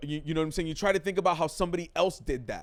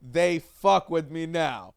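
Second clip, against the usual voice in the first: proud slow boast; 2 semitones higher, 6 dB louder, much slower than usual. Retold or imitated. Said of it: "You're like".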